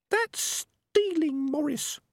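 very high, slow squeaky rat voice; prim little rat. A narrator's reading. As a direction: scold him gently from way up high.

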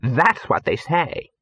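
put-on voice of a complaining girl; indignant actress. Actress repeats what complainers are told, indignant. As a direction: recite the grievance indignantly.

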